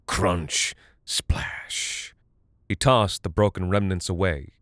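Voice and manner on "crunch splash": breathy whispered splash; whispered sound effect. A narrator's reading. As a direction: breathe the word out like water.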